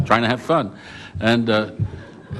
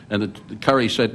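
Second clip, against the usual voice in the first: normal talking voice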